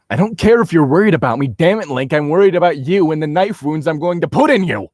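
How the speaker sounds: loud, fast, stubborn outburst; stubborn retort. Acted. Jin snapping at Link she won't back down, loud stubborn retort. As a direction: fire it back fast and loud, refusing to budge. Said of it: "I retorted laughs stubbornly".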